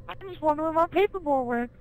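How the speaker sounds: very high, squeaky cartoon voice. Retelling the episode, wondering where the paperboy went in a very high voice. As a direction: pitch way up like a cartoon character.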